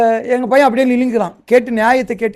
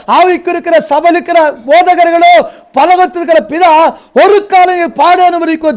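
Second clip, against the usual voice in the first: raised, loud preacher's exhortation; full-force preaching; 6 semitones higher, 5 dB louder than usual. Acted. Pastor urging the fathers, full-force preaching. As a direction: preach it out, building and pleading.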